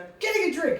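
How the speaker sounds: high, loud defensive retort; flustered answer. Retold or imitated. Grown child answering naked dad, flustered. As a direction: blurt it back, acting flustered.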